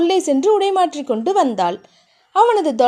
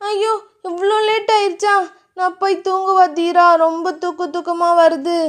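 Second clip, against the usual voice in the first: put-on higher, sleepy girlish whine; 4 semitones higher than usual. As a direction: complain drowsily. Narrator voices Thiya.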